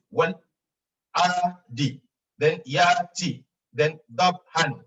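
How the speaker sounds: Wal adi, yati, dabhan sung note by note; split into notes. Shown as a demonstration. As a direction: give each chunk its own note. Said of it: "listen to the notes".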